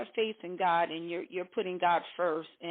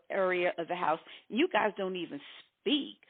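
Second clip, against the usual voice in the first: ordinary talk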